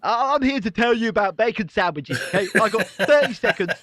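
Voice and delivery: hint of prim politician pitching to camera; Liz Truss voice. [name] mocking a Labour TikTok in a lightly voiced Liz Truss. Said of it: "This is very like your Liv, Liz Truss voice".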